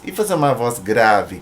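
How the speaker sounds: ordinary talk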